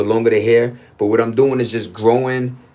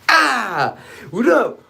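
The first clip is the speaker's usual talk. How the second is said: excited yell; 10 semitones higher, much slower than usual. Real, not acted. Excited exclamation.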